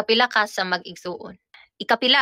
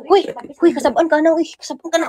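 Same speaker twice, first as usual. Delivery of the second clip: scolding; 6 semitones higher, 7 dB louder, faster than usual. Real, not acted. Scolding noisy child.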